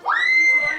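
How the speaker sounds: hit hard crying out. Real, not acted. Urging team to close the door.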